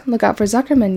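usual speaking voice

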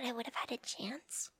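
soft, hopeful whisper; whispered aside. Acted. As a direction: wonder it to yourself. Wistful aside to herself.

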